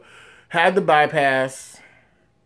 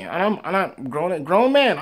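ordinary talk, nothing marked

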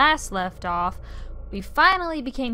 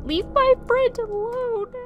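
much higher, sharp squeaky character voice; 13 semitones higher than usual. Acted. Game character shrieking at Fran, in a much higher voice. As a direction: snap it out in a tiny squeaky voice.

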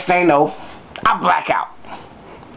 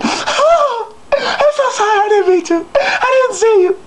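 high falsetto mock sobbing; squeaky imitated tearful pleading; crying woman. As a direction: wail the apology like a distraught woman, hammed up. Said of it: "she's still over me crying".

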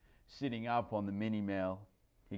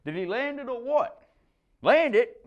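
excited banter; 13 semitones higher, 7 dB louder than usual. A narrator's reading. Surfers' banter.

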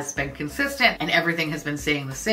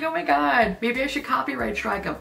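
mock shock; 6 semitones higher than usual. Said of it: "I was like".

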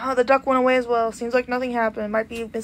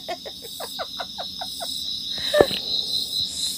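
airy wheezing laugh, much higher; high breathy laugh; 8 dB quieter than usual. Real, not acted. Laughing at Peter Griffin.

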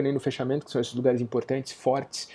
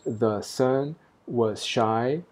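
very slow, "sun" and "shy" in "The sun was shy" stressed on the beat; clear iambic feet; much slower than usual. Shown as a demonstration. Teacher showing the opening feet, slow and stressed. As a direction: tap out "The sun was shy" as two clean iambs. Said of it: "os dois pés iniciais muito claros".

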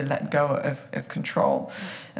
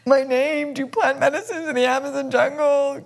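laughing; 9 semitones higher, 8 dB louder, slower than usual. Acted. Mock list of child's rebellion.

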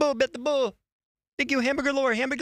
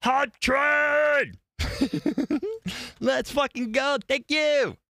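excited shout; 5 semitones higher, slower than usual. Real, not acted. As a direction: yell it out to the chat, thrilled. Hype announcement to chat.

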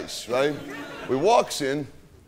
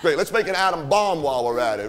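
ordinary talk, nothing marked

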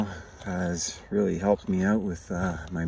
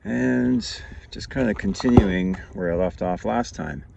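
ordinary talk, nothing marked

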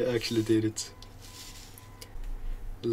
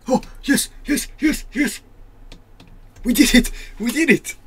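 excited cheering; 13 semitones higher, 9 dB louder than usual. Real, not acted. Beating the last night.

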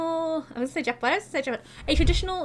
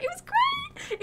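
gushing; 3 dB quieter, much slower than usual. Real, not acted. Answers her own question.

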